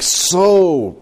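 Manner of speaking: hammed-up self-important disciple; boastful Peter. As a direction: brag slowly, relishing your own strength. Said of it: "I mean, he's like".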